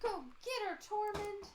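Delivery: high excited cheer for the cat. Real, not acted. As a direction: urge the cat on.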